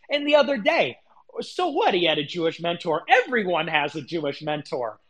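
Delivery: ordinary delivery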